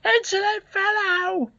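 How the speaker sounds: very high, drawn-out outrage; indignant exclamation. A narrator's reading. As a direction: exclaim like an affronted housekeeper. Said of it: "exclaimed the housekeeper".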